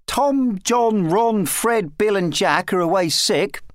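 put-on high-pitched boy's roll call; young lad. A narrator's reading. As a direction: count off names as a small boy. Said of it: "said Ralph, counting on his fingers".

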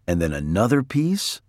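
usual speaking voice

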